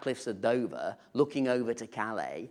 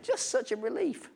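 laughing; 11 semitones higher, 3 dB quieter than usual. Real, not acted. Joke about irritating neighbours.

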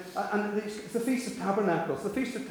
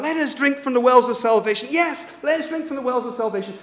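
high, raised ritual cry; calling out; 8 semitones higher, 6 dB louder than usual. Retold or imitated. Priests and people at the feast, calling out. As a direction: call it out excitedly to the crowd. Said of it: "the priests have been calling out".

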